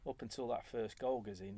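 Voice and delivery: ordinary delivery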